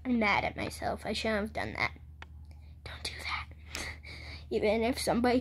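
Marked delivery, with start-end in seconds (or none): 2.8-3.9 whispered, much softer warning. Real, not acted. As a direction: hush it as a warning.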